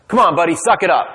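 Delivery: gruff dad pretending to be harsh; tough-love brush-off. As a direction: push the kid to toughen up. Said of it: "No, I, I thought that".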